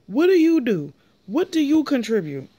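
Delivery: fed-up outburst at Kathy; exasperated shout. Real, not acted. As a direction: yell it at the screen. Ranting at Kathy on the show.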